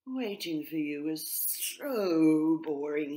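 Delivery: bored blue tit yawning at the leader. A narrator's reading. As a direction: stretch it out as you yawn. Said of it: "he said with a big yawn".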